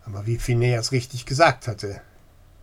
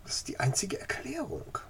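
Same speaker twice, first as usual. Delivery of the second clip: whisper; 9 semitones higher, 7 dB quieter than usual. Acted. Phineas insists quietly.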